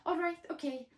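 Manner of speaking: high, quick appeasing; high nervous giving-in; hasty agreement. Acted. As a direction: placate him fast. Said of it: "Mole agreed quickly".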